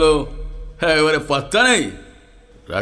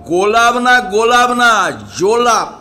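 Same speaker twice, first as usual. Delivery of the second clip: raised, higher exasperated scolding; exasperated correction; 4 semitones higher than usual. Acted. Boss corrects hard-of-hearing servant's mishearing, exasperated.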